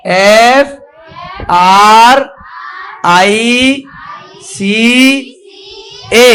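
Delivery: one letter at a time, long and rising; spelling out. Shown as a demonstration. Teacher shows children the spelling, stretched letter by letter. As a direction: spell it slowly with a gap after each letter. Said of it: "spelling कैसे करेंगे".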